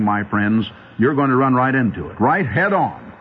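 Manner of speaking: normal talking voice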